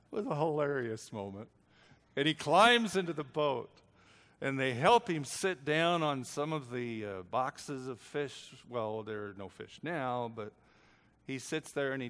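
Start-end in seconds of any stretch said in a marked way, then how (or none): none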